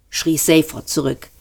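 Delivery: plain reading voice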